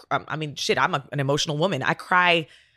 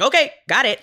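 a higher, louder eager "okay"; cheery compliant kid; 6 semitones higher, 5 dB louder than usual. Retold or imitated. Mom imitating her agreeable son, bright and eager. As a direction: play the easygoing obedient boy.

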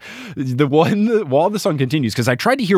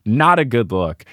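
normal talking voice

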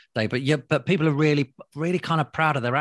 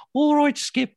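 high-pitched, loud mock Midlands accent; 5 dB louder, slower than usual. TV host recalling his one Midlands voice from the show, mock Midlands accent. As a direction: put on a cartoonish high Midlands twang. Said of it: "only had one accent for the Midlands".

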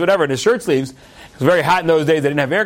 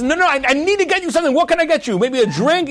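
eager insisting; 8 semitones higher than usual. Retold or imitated. Rabbi insists on serving guest.

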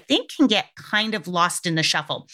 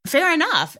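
high, bright-eyed "oh, okay"; a pitched-up surprised "fair enough"; surprised acceptance; 6 semitones higher, slower than usual. Retold or imitated. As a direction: caught off guard, then giving in. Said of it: "I was like".

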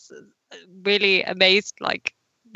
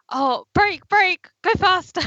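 high-pitched excited mock urging; urgent exclamations; 9 semitones higher than usual. Re-enacting feeling it while watching the race, urgent and high. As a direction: act out urging the driver on, voice pitched up. Retelling her shout at screen.

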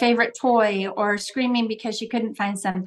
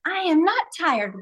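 preschooler protest; 7 semitones higher than usual. Retold or imitated. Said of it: "Whenever they're like".

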